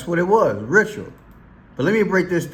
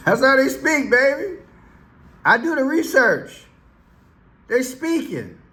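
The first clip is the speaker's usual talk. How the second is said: gleeful triumph; 12 semitones higher than usual. Real, not acted. Vlogger gloats to viewers about his research, laughing and high. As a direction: crow it like you cracked the code. Gleeful reveal of hidden symbolism.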